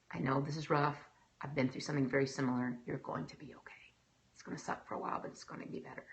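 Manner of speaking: low, soft consoling voice; gentle reassurance. Acted. Encouraging someone going through hardship, low soft reassurance. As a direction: reassure softly, sinking toward a near whisper.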